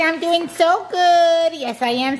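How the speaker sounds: high, slow, put-on dog voice; voicing the dog. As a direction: stretch it out like the dog talking.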